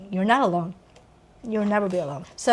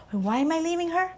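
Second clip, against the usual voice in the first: puzzled husband; 7 semitones higher, 4 dB quieter than usual. Retold or imitated. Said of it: "walk away and go".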